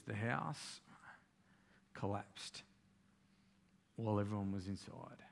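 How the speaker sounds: soft, low and halting; grave hush with heavy pauses; breaking tragic news. Retold or imitated. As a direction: play a messenger reluctant to go on. Employee reports deaths of boss's children.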